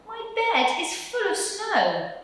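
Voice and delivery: small, high squirrel voice; a tiny cold squirrel asking. Acted. Squirrel at Percy's door, high and pleading. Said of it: "said the squirrel".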